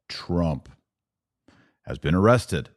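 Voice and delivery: ordinary talk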